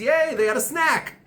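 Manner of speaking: high-pitched sarcastic yay; put-on gushing excitement; mock cheering. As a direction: celebrate something trivial with fake glee. Mock cheering over trivial detail.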